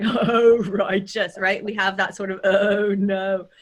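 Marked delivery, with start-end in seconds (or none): 0.0-1.4 Californian surfer with gliding O; surfer-dude California accent. Shown as a demonstration. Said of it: "if we're surfing". 2.4-3.5 "Oh" glided the Californian way, wide; California O. Shown as a demonstration. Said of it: "a glide into our California".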